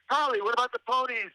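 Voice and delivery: very high, put-on pestering cry; re-enacted high-pitched plea; mock heckling call. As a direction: call out to the candidate in a squeaky-high voice. Re-enacts calling out to candidate.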